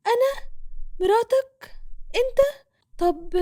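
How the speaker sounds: very high, halting gasps of disbelief; shocked disbelief. Acted. Hana shocked to hear she is Reda's wife, very high halting disbelief. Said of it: "اتصدمت من كلامه".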